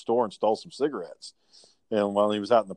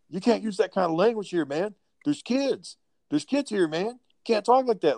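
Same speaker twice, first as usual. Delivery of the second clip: higher, laid-back Californian dude voice; Californian guy; 9 semitones higher than usual. Retold or imitated. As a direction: play Jimmy as a Californian telling him off. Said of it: "so he sounds like a Californian guy".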